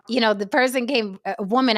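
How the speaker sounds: ordinary talk, nothing marked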